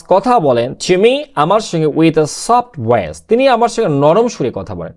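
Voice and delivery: ordinary talk, nothing marked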